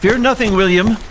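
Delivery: high, loud call of comfort; reassuring shout. Acted. Uncle calling comfort to nephew, high and loud. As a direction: shout courage across the water.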